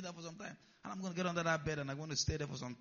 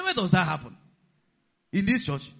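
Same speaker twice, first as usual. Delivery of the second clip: mock shock; 3 semitones higher, 9 dB louder than usual. Mocking a movie church scene.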